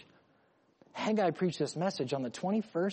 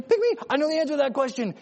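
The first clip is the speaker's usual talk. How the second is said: high, fast schoolkid plea; eager little kid; 9 semitones higher, 4 dB louder, much faster than usual. Acted. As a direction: burst out like a kid desperate to answer. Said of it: "you have this little kid".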